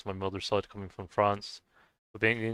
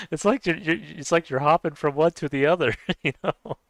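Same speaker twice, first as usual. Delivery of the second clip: raised pitch, words broken up by chuckles; helpless laughter; 6 semitones higher, 5 dB louder than usual. Real, not acted. Teasing friend Joe about switching heritage, laughing. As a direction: talk through a real laugh, unable to hold it in. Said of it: "I can't help but laugh".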